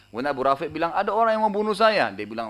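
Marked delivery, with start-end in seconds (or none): 1.0-2.1 retold alarmed cry. Victim cries out for help.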